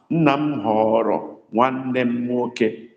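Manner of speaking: Nna m họrọ nwanne m nwoke said slowly, every word set apart; slow it right down for them; slow dictation. Shown as a demonstration. Teacher dictating to students, slow and clear. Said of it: "understand the sound".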